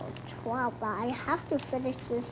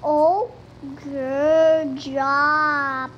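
loud excited praise; 12 dB louder, much slower than usual. Real, not acted. Praising own success.

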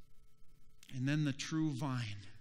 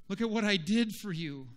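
higher-pitched, insistent plea; raised appeal; 4 semitones higher, 4 dB louder than usual. Acted. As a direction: press it on them with raised voice. True vine pleading with branch.

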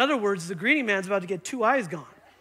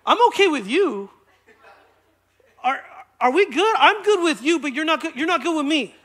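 loud, raised-pitch insistence; emphatic acted reconciliation; 5 semitones higher, 6 dB louder than usual. Pastor making peace with a slanderer, loud and insistent. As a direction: press it home loudly, insisting all is forgiven.

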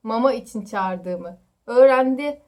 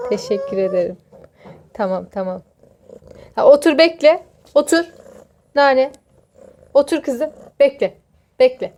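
louder firm pet commands; firm commands to a cat; 2 semitones higher, 9 dB louder than usual. Real, not acted. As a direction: tell the cat to sit and wait.

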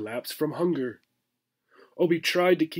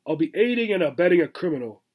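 rattled off; 3 semitones higher, 3 dB louder, faster than usual. Acted. Squirrel rattles off excuse.

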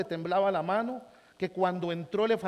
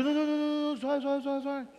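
pleading calm down; 6 semitones higher, slower than usual. Retold or imitated. Calming the gringa down.

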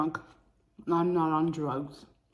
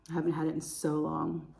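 usual speaking voice

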